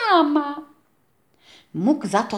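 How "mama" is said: high happy chick squeak; joyful squeak. A narrator's reading. Chick greeting the rabbit as mother, happy squeak. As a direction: squeal it with delight. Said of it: "kulka zapiszczała radośnie".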